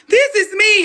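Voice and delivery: hammed-up high-pitched quote; mock high squeal. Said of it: "even she was like".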